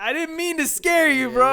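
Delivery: high, loud self-defence; hammed-up protest of innocence; pleading apology. Acts out protesting his innocence.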